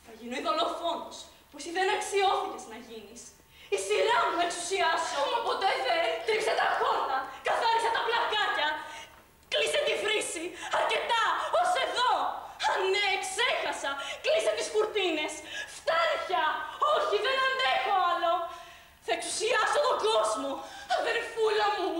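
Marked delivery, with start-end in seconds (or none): 3.8-15.3 high, loud, laughing tirade of commands; manic laughing rant. Acted. Maid's mad fantasy of power. 15.8-16.5 much higher, loud shriek; shrieking outburst. Acted. Rant peaks. 16.9-21.9 high, loud, laughing tirade of commands; manic laughing rant. Acted. Maid's mad fantasy of power.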